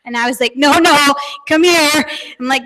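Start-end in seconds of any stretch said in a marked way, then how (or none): 0.6-2.1 louder no no come here; firm call. Retold or imitated. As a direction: call him over firmly. Mom calling the boy over.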